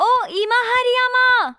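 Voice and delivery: loud, high, drawn-out call. Acted. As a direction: holler her name to get her attention. Calling out to the girl. (ज़ोर से लंबी पुकार)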